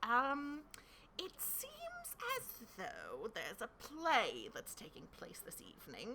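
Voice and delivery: lightly voiced fussy king; a hint of a worried king.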